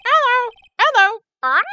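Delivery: parrot voice. Acted. Imitating parrot.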